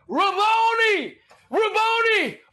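high, drawn-out calling; acted cry of recognition. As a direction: call the name out high, stretching each one. Re-enacts Mary's cry to the teacher.

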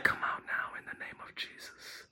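very soft, voiceless whisper; hushed, secret deliverance whisper; whispered rebuke. Shown as a demonstration. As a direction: whisper the command under your breath. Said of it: "I start talking like that".

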